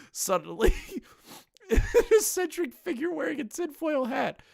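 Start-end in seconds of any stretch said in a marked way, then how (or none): 1.3-4.4 high voice breaking with laughter; rereading through giggles; laughing. Real, not acted. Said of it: "I gotta read that again".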